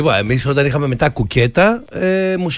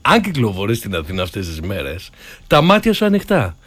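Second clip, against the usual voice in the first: more erotic; 5 semitones lower than usual. Pretend. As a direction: croon the announcement low, like a playful seduction. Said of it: "θα το πάω πιο ερωτικά".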